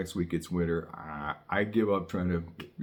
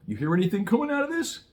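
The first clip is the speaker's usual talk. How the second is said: pitched way up and rushed; high, fast question; 11 semitones higher, 3 dB louder, faster than usual. Shown as a demonstration. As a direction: ask it high and quick like a teasing test. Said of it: "Air, air".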